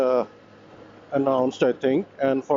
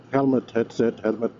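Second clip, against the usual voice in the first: normal talking voice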